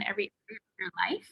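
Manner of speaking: quick whispered aside. Real, not acted. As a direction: whisper it quickly, drawing them in. Intimate aside to viewers.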